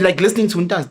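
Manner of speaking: normal talking voice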